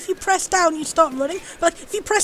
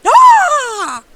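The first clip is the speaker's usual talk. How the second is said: loud high cry, falling away; exclaimed "ah"; 7 semitones higher, 10 dB louder than usual. Real, not acted. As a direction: cry out loudly on one long falling note. Startled cry during game scene.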